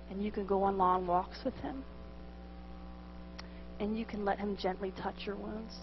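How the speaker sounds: very soft, low, with long pauses; tender priest's offer. Retold or imitated. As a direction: gently offer rest, imitating a soothing priest. Priest tenderly offers second choice.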